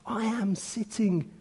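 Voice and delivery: soft, drawn-back preaching voice; hushed plea. Acted. Cobbler speaks as Christ dead and risen, hushed plea. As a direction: let the plea fall to a reverent hush.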